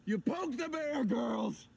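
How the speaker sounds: loud, high-pitched fury; enraged shouting. Acted. Dad raging at his girls, loud angry shouting. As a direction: roar at them, loud and worked up.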